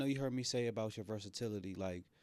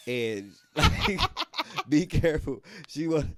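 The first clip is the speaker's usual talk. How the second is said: laughing; 5 semitones higher, 12 dB louder, slower than usual. Real, not acted. Laughing while telling story.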